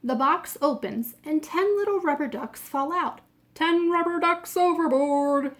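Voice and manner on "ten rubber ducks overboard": loud, raised call across the deck; captain shouting. Acted. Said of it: "shouts the captain".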